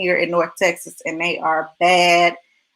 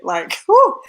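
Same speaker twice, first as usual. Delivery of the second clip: a high, loud excited whew; 11 semitones higher, 5 dB louder than usual. Real, not acted. Telling viewers she's excited about the topic, high loud whew. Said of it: "'cause I'm excited".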